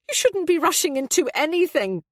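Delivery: high, loud petulant scolding; petulant mother warning her son. A narrator's reading. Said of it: "Abby sounded petulant".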